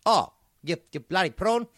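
put-on loud, high South African accent; hammed-up Johannesburg accent. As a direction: bark it out as a comic South African. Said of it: "you sound a bit South African".

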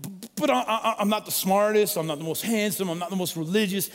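normal talking voice

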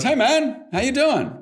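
high, loud, eager hello from the shop guy; cheery shopkeeper greeting. Retold or imitated. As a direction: greet me like an overjoyed night clerk. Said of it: "And, and he goes".

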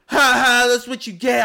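imitating a bratty kid; taunting kid. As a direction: gloat like a bratty child. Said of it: "he, like".

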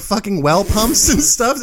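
ordinary talk

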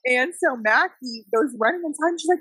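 ordinary delivery

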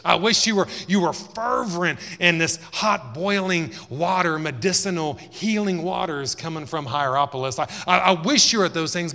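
high and loud, pleading; loud pleading rebuke. Acted. Jesus wishing the church were cold, high loud plea. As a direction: cry it out with longing. Preacher voicing Jesus' wish.

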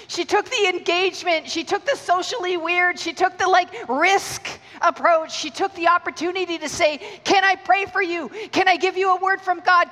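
high, loud preacher's climb; preaching build. Acted. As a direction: stir the room with each phrase. Preaching at full force.

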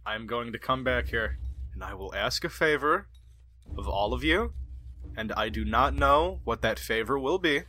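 low, soft, ominous promise; low and quiet, like a brooding hero's oath; solemn vow. Retold or imitated. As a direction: swear it gravely and quietly. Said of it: "and he's like".

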